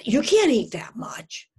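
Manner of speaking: acted alarm, pushed hard; nagging eating-disorder voice. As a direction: scold like an alarmed voice on the shoulder. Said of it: "this little eating disorder that sits on their shoulders saying".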